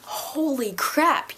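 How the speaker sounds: drawn-out slow amazed; slow awe. Retold or imitated. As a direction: stretch it out in disbelief. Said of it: "And I'm like".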